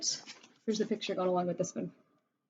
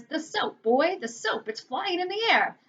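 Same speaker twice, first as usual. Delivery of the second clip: astonished father; 8 semitones higher than usual. A narrator's reading. Startled father in story.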